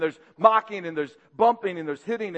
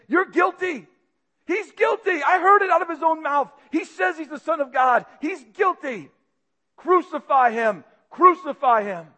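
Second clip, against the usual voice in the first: much higher, shouted mob chant; mob yell; 7 semitones higher, 4 dB louder than usual. Acted. Priests and elders condemning Jesus, furious yelling. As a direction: roar it as an angry crowd. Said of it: "begin to yell with one common voice".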